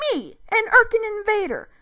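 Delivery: high, loud indignant alien cry; shrieking alien indignation; outraged alien. A narrator's reading. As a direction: act insulted as a mighty invader. Zim outraged at being bitten.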